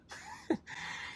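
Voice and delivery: quiet breathy chuckle; soft laugh. Real, not acted. Rancher laughs softly at his own story punchline.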